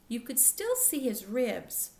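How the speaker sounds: usual speaking voice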